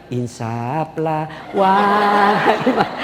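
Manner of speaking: very slow, hammed-up dramatic plea breaking into a laugh; mock solemn plea. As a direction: drag it out like a melodramatic sermon. Mock pleading to calm wife. (permohonan dramatis pura-pura, sangat pelan)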